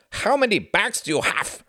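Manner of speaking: high, hammed-up German accent; mock German accent. A narrator's reading. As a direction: ask it in a jokey German accent. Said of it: "asked Klaus in a mock German accent".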